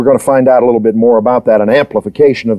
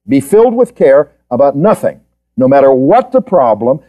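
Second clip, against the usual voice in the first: ordinary talk, nothing marked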